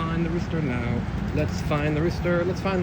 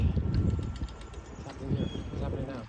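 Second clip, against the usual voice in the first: whisper; 3 semitones lower, 7 dB quieter than usual. Real, not acted. Whispered puzzlement.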